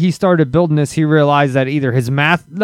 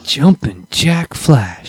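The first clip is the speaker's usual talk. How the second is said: put-on old prospector; much slower than usual. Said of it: "they're always like".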